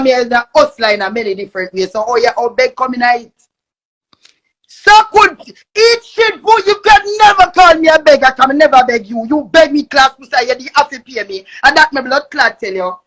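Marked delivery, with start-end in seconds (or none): 4.7-8.3 high, loud furious yell; angry yelling. Real, not acted. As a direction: blow up at her.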